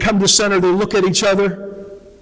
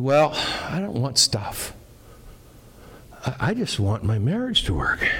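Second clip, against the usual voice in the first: sad wish; 4 semitones lower, 7 dB quieter than usual. Acted. Third man's heartfelt wish.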